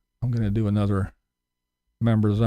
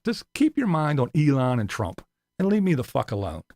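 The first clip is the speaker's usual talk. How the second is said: ordinary talk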